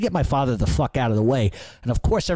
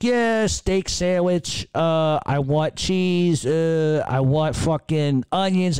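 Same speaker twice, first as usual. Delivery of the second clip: slow, higher mock drive-through order; drawn-out, pitched-up ordering like at a McDonald's speaker; 6 semitones higher, much slower than usual. Father ordering a cheesesteak like a McDonald's drive-through order. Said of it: "like it's a fucking drive-through at McDonald's".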